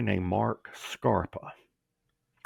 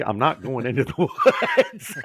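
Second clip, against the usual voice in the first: laughing; 8 semitones higher, 5 dB louder, faster than usual. Real, not acted. Laughs through his own retort.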